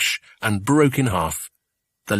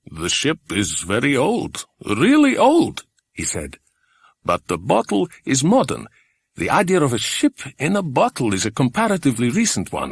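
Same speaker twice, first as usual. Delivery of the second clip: put-on older man voice; 4 semitones higher than usual. Mister Eppie voiced.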